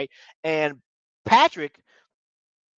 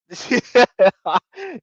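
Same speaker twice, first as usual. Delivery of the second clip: real laugh; 8 semitones higher, 7 dB louder than usual. Real, not acted. Laughing at his own joke, real high laugh.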